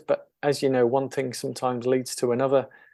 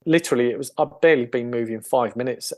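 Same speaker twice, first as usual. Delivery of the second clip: normal talking voice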